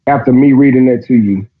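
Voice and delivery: ordinary talk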